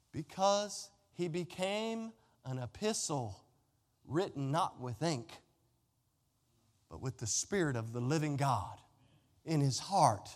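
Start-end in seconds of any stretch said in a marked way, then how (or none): none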